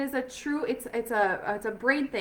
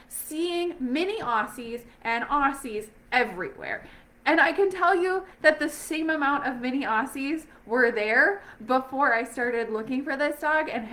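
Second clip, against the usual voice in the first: ordinary talk, nothing marked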